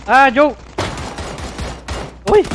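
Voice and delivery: loud excited yell; panicking gamer. Real, not acted. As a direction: shout it out in the heat of battle. Dying in firefight, yelling.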